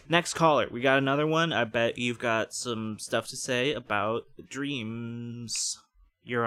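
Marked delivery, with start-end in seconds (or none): 0.1-1.5 announcer. Acted. Radio host announcing next caller.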